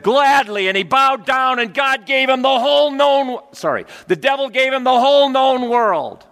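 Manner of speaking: high and loud gushing; mock eager. Preacher mocking the man who bowed to the devil, loud eager. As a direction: overplay the eager yes.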